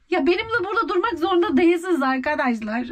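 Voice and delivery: normal talking voice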